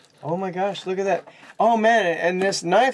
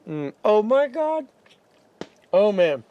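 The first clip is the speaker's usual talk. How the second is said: savoring; 4 semitones higher than usual. Real, not acted. Tasting the ribs.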